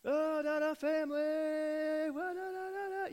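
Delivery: high, hammed-up TV host yelling through a megaphone; preacher imitating the makeover host's megaphone call. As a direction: ham up the show host on the megaphone. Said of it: "he gets the megaphone".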